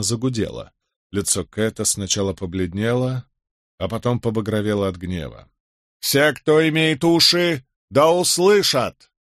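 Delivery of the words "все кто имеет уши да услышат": loud, high, slow decree; stern haughty herald. A narrator's reading. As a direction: read it down to rebels. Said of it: "продолжил чтение посланник". (суровый надменный глашатай)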